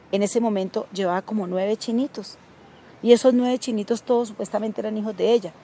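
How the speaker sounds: normal talking voice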